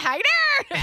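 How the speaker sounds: high, loud cheesy greeting; hammed-up catchphrase. [name] copying Miguel's silly greeting, high and loud. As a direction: mimic the pickup line. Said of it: "He'd be like".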